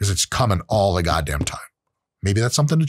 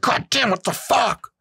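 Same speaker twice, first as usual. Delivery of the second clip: high, drawn-out mock exasperation; irritated outburst; 13 semitones higher, slower than usual. Rushed shopper swearing at a bad bagging job, mock angry. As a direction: act out snapping at the bagger. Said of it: "you're like".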